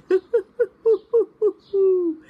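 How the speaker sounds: high, loud hooting owl laugh; mocking laughter. A narrator's reading. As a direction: cackle at your brother. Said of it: "laughed and hooted".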